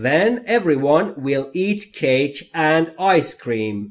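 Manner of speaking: "Then everyone will eat cake and ice cream" said as one slow, even line; whole sentence slowly. Shown as a demonstration. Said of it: "whole sentence after chunks".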